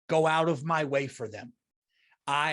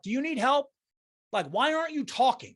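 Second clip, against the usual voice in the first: higher, exasperated question; incredulous challenge; 8 semitones higher than usual. Pretend. To the silent lady in the car, exasperated and pitched up. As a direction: demand an answer from a silent stranger. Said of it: "knocked and said, like".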